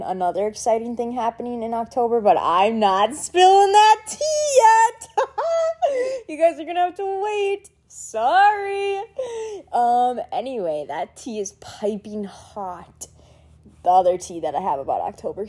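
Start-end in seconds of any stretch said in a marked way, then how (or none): 2.2-5.1 much higher and slow, playfully withholding; coy teasing. Real, not acted. Teasing fans about secret news. 5.1-6.2 squeaky high-pitched giggle. Real, not acted. Giggle after teasing. 6.3-9.0 much higher, playful apology; coy teasing. Real, not acted. Teasing fans about secret news. 10.9-13.1 slow secretive whisper; conspiratorial whisper. Real, not acted. Secret aside to viewers.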